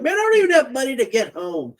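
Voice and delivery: whiny brother. Retold or imitated. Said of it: "He was like".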